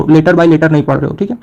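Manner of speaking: ordinary talk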